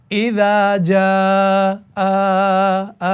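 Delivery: the aa of जा pulled out alone, आ, आ; stretched vowel; madd demo. Shown as a demonstration. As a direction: hold the open आ sound from the mouth. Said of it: "अच्छा".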